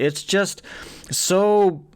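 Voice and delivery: ordinary talk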